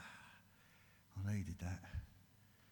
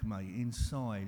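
ordinary talk, nothing marked

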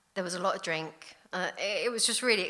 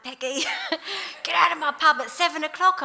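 put-on drunken yell at customers; 10 semitones higher, 8 dB louder than usual. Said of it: "telling people to get out".

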